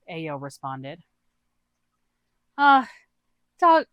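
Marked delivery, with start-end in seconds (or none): 2.6-3.9 much higher and louder, flustered; a nervous squeak of protest; Booma's high voice. Acted. Booma jumps in about the shark, high and flustered.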